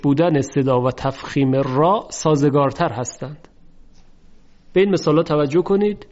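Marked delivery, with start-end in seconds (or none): none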